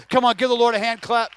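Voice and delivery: normal talking voice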